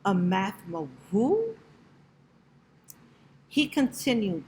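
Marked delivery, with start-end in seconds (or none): 0.1-1.6 puzzled exclamation. A narrator's reading. Baffled parents answer the teacher, exclaiming in surprise. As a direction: echo the word in disbelief, lifting sharply on "who". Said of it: "They both exclaimed".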